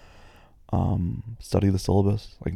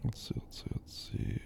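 whispered thinking; 14 dB quieter than usual. Real, not acted. Thinking aloud, searching questions.

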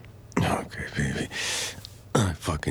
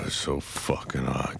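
normal talking voice

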